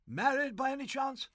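a hint of an over-cheery, tipsy prize-giver; chummy and a touch sloshed, lightly played; lightly voiced tipsy Gussie. Tipsy Gussie chats to a prize-winning boy, lightly voiced.

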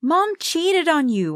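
high, slow, drawn-out yell; yelled accusation. A narrator's reading. Child telling dad about mom's cheating, yelled accusation. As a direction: yell it out, pitched well up. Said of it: "I yelled".

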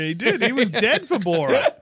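high laughing talk. Real, not acted. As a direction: laugh through the words. Laughing hard at joke.

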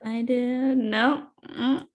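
ordinary delivery

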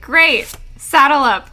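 loud, eager call to ride; rally them, raring to go; eager shout. Retold or imitated. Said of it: "And they're like".

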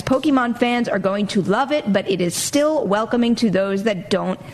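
usual speaking voice

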